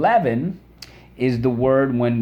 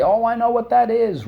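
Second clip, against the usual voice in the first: much higher-pitched mock recognition; imitating eager pupils; 12 semitones higher, 3 dB louder than usual. Teacher voicing everybody recognising the word, high mock-eager voice. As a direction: pipe up high as a know-it-all class. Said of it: "I could hear the, everybody".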